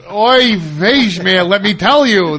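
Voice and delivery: hammed-up Yiddish exclamation; Yiddish accent. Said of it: "Rabbi Bruno Sammartino Berg".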